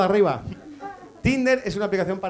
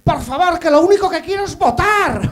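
mock Catalan woman; 10 semitones higher, 7 dB louder than usual. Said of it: "de repente dice".

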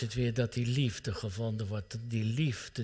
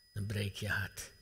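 quiet, lower, but voiced; a hushed, spoken echo; soft voiced echo; 3 semitones lower, 6 dB quieter than usual. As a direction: repeat "Dan breek je hart" softly, with voice back in it.

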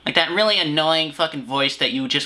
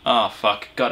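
ordinary delivery

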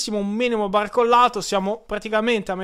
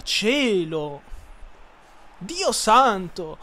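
usual speaking voice

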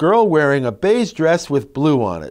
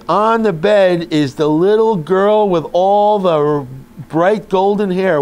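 put-on slow, louder reading-to-children voice; storytelling voice; 3 semitones higher, 3 dB louder than usual. Shown as a demonstration. Parent reading Goldilocks aloud to a child, storytelling voice. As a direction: read it like a bedtime storybook to a child. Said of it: "help you tell the story".

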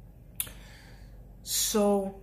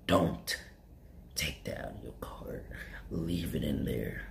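very low, hushed whisper; 6 dB quieter than usual. Retold or imitated. Cashier mocking a customer's hushed plea. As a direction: breathe it low and secret, slow.

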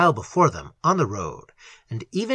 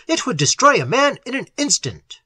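high-pitched, forceful warning; urgent shout; 8 semitones higher, 3 dB louder than usual. A narrator's reading. Little warning about the light's power, shouting. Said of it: "shouted Little".

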